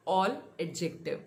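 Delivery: ordinary talk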